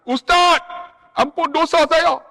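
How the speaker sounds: put-on old man begging the ustaz to forgive his sins; pleading pilgrim. Pilgrim begs forgiveness tearfully.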